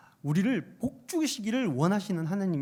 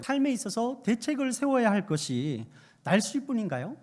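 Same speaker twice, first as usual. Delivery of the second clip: normal talking voice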